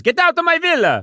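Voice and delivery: put-on high, Italian-accented villa owner ordering them out. Italian villa owner kicking out the friends, in an Italian accent. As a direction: bark it like an offended Italian host. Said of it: "Your Italian accent".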